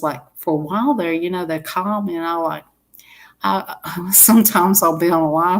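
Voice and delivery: usual speaking voice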